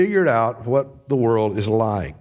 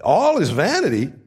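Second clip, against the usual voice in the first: higher, insistent demand for a reason; pressing question; 5 semitones higher than usual. Pretend. Preacher voicing the listener demanding why, insistent. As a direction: push back hard, demanding why. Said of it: "you need to say".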